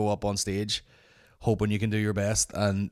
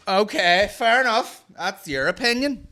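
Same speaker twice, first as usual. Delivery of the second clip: high, louder mocking dismissal; sarcastic heckler; 11 semitones higher, 6 dB louder than usual. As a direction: drip with mock agreement. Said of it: "she would go".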